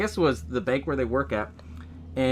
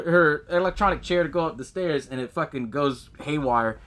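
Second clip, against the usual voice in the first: ordinary delivery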